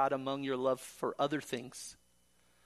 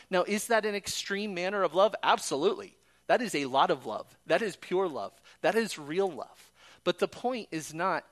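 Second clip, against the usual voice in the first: louder, punchy short declarations; emphatic preaching; 4 semitones higher, 6 dB louder than usual. Acted. Preacher proclaiming to the congregation how great God's love is. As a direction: hammer each short line home.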